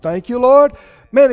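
usual speaking voice

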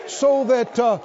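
high, rhythmic preaching building in excitement; rousing testimony from the pulpit; fired-up preaching. Real, not acted. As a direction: preach it to a cheering congregation, piling up phrases. Preaches to the congregation at full force.

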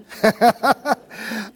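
hearty chuckle at own joke; real laugh. Real, not acted. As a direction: burst out laughing briefly.

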